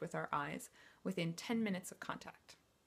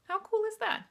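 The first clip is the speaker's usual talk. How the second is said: much higher and louder, delighted; excited; 14 semitones higher, 7 dB louder than usual. Real, not acted. Coach thrilled by the stress science, excited high exclaim. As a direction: sell the wonder of it to the audience.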